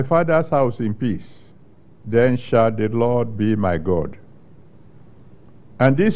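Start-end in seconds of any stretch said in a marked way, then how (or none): none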